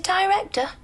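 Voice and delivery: "Director" pitched high and tossed back; incredulous echo. Retold or imitated. Wardrobe man scoffing at the actress's guess, incredulous. Scornful echo of her word.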